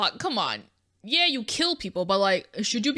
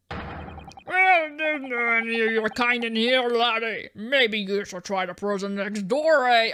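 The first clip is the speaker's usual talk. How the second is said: gruff Scottish governor, slow and heavy; a hard Scottish warden putting a visitor in his place; Scottish prison governor; slower than usual. Acted. Prison governor greets the lawyers in a thick Scottish brogue. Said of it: "can't decide on the voice".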